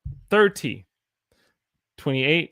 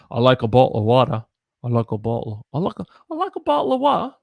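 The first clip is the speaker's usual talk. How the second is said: hammed-up British; 5 dB louder, faster than usual. Shown as a demonstration. Teacher parodying a British speaker. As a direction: overdo a Brit asking for "a bottle of water". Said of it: "The British, the British".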